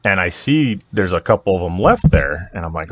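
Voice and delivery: normal talking voice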